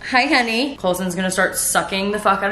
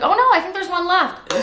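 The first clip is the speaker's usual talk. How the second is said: high-pitched mock store-employee voice; imitated shop worker; 11 semitones higher than usual. Sephora worker telling her there's stock, high imitated voice. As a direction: put on a high, helpful clerk's voice.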